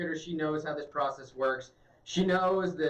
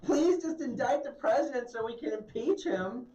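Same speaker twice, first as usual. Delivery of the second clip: higher, whiny mock plea to indict the president; mock pleading senator; 5 semitones higher than usual. Mocking the senator, begging Barr to indict, pleading voice. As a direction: beg like an exaggerated caricature.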